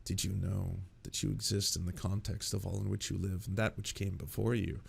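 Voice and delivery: low, hushed musing voice; philosophy obsessive. Acted. Philosophy obsessive sitting there musing about context, low and quiet. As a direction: muse it softly and low, like an earnest philosopher. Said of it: "someone who's just, like, deeply, deeply obsessed with philosophy".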